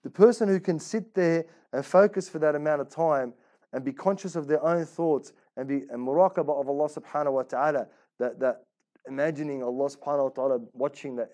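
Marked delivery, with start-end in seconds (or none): none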